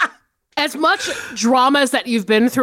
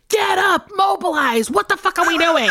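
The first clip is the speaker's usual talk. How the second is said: higher, half-yelled wake-up call to lazy relatives; mock-bark at a couch full of relatives: get moving; exasperated rallying shout; 6 semitones higher than usual. Family sitting around, calls them out.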